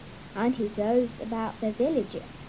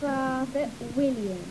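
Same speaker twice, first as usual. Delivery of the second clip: usual speaking voice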